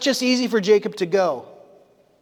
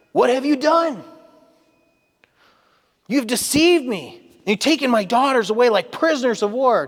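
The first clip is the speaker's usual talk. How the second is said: raised, indignant accusation; accusing Laban; 6 semitones higher, 4 dB louder than usual. Retold or imitated. Preacher voicing Laban accusing Jacob, mock indignant. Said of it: "Laban said to Jacob".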